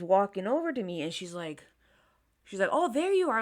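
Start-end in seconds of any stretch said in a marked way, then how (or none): 2.7-3.4 high, louder imitated mom exclamation; mom finding her. Mom walks over to daughter, mock relieved call. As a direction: mimic a mother spotting her kid.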